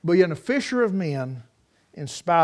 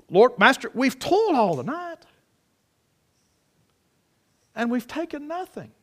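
higher, pleading protest; arguing; 7 semitones higher than usual. Retold or imitated. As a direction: push back at the Lord, half-arguing. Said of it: "it's almost like he's arguing".

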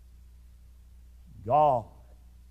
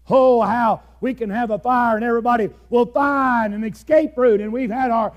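loud, raised preaching voice; fiery preaching; 6 semitones higher, 6 dB louder than usual. Acted. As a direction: press the point hard on the congregation. Preaching at full force, shouting.

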